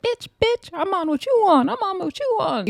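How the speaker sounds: high, squeaky mock challenge; mocked brawlers. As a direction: caricature fighters egging each other on. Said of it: "And they're like".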